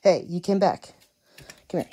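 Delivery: ordinary talk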